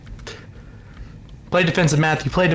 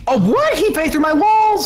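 shocked outcry; 13 semitones higher than usual. Real, not acted. Teammate shot through walls.

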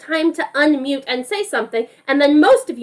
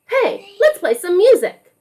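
eager invitation; 6 semitones higher, 4 dB louder than usual. Acted. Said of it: "said Miles".

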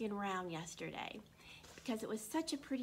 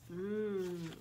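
yummy mmm; 4 semitones lower than usual. Acted. Character savouring ice cream.

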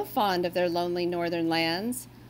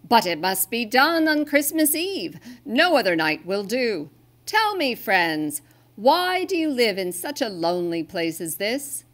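louder, higher earnest Santa voice; Santa explaining; 3 semitones higher, 6 dB louder than usual. Acted. Santa asks the reindeer why they hide, loud kindly voice. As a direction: press the point, then ask kindly.

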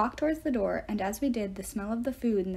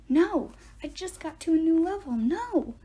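high loud whiny protest; protesting shout; 8 semitones higher, 4 dB louder than usual. A narrator's reading. Grover dragged from his game, high loud protest. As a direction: yell it while being dragged away. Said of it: "as he shouted".

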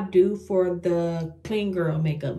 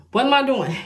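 exasperated, louder; 5 semitones higher, 7 dB louder than usual. Real, not acted. Exasperated at herself.

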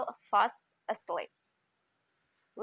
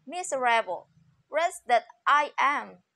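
crying out; 3 semitones higher, 6 dB louder than usual. A narrator's reading. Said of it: "he again cried out".

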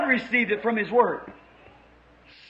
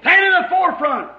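raised, forceful pulpit command; fervent exhortation; 3 semitones higher, 5 dB louder than usual. Real, not acted. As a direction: call the congregation to arms, loud and high. Preacher exhorts at full force.

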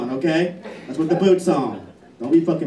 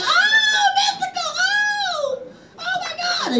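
mock girly screaming; slower than usual. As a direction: squeal like a fan hearing her song. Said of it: "Y'all scream and holler".

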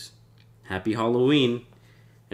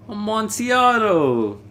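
high, loud, slow cry of longing; drunken rapture; 12 semitones higher, 6 dB louder, slower than usual. Acted. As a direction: howl the wine's name like a man possessed. Fortunato craving the wine.

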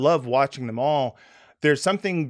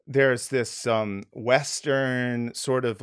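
ordinary talk

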